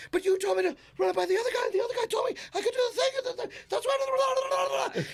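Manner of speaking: high, rushed self-defence; frantic mock protest. Comic defends himself to manager, imitated frantic protest. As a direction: gabble excuses at a high pitch.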